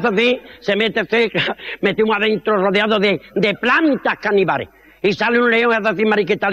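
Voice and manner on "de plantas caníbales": normal talking voice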